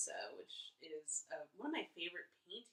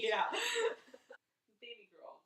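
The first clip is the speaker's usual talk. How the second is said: usual speaking voice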